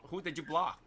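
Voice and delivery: ordinary talk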